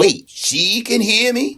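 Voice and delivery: Patty startled to be heard, drawn out. Acted. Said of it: "Shut up, Patty".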